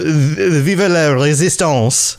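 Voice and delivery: cartoon French accent, hammed up like Pepe Le Pew; mock French accent. Podcast host teasing his co-host with a jokey French accent. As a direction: toss off Vive la Resistance in a jokey French accent. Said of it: "my funny Pepe Le Pew accent".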